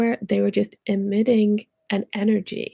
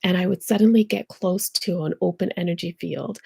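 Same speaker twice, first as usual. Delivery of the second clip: ordinary talk, nothing marked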